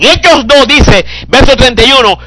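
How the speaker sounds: loud, high shouted scripture; preaching at full force. Acted. Preacher crying out Acts to congregation, loud fervent preaching. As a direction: shout the verse like a revival preacher.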